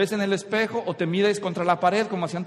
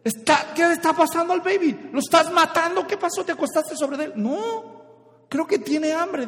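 much higher, frantic, scared new father; panicked husband; 9 semitones higher, 3 dB louder than usual. Acted. Startled husband woken at one a.m., panicked high voice. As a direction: squeal it out in panic. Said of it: "el esposo despierta bien asustado y dice".

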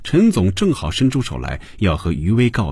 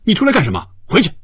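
sharp high-pitched scolding yell; angry bark, pitched far up; roaring; 13 semitones higher, 4 dB louder, much faster than usual. A narrator's reading. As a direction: yell at a subordinate to get back inside. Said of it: "托尼转头对他吼着".

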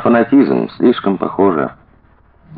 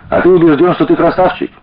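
teasing question climbing high; mock-innocent banter; playful fooling; 10 semitones higher than usual. A narrator's reading. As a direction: tease lightly, lifting the pitch at the end. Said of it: "дурачилась Маша".

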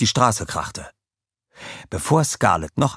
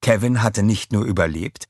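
ordinary delivery, nothing marked

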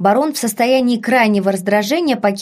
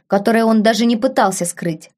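ordinary delivery

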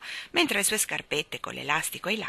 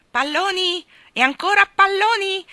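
very high, loud street vendor call; high-pitched sing-out of a balloon seller; shrill cry; 13 semitones higher, 10 dB louder than usual. A narrator's reading. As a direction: call your wares in a piercing voice. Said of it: "gridava una voce acuta".

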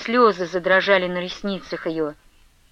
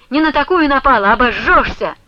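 raised, louder voice of defiance; angry retort; 4 semitones higher, 6 dB louder than usual. A narrator's reading. Ustinya warning off her rival, angry retort. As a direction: snap back defiantly.

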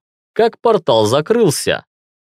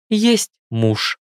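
playful; 8 semitones higher than usual. A narrator's reading. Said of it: "Юля шутливо встала по стойке".

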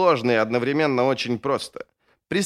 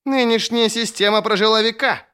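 high, forceful bark; 9 semitones higher, 4 dB louder than usual. A narrator's reading. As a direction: bark it indignantly across the council table. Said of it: "рявкнул Сульт".

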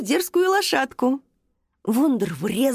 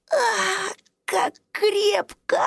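high, slow, squished little squeak; squeaky squeal; 10 semitones higher, much slower than usual. Acted. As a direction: squeak as if hugged too tight. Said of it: "тихо пискнуть".

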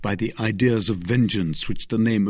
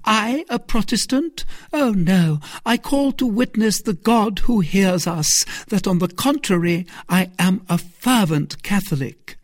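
lightly voiced fervent Milady, higher; a hint of a lady swearing her piety; 11 semitones higher, 4 dB louder than usual. A narrator's reading. Milady protests her faith.

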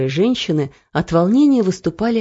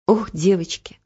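sorrowful. Acted. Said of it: "горестно покачала головой".

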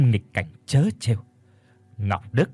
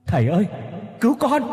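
high-pitched plea, calling out; desperate cry for help; 9 semitones higher, faster than usual. A narrator's reading. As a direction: call for help with everything left. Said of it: "kêu lên bốn chữ".

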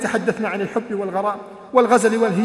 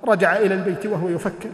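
normal talking voice

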